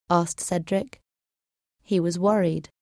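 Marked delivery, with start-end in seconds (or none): none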